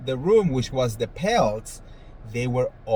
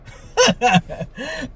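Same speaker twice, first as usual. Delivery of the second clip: laughing; 12 semitones higher than usual. Real, not acted. Laughs after fantasy.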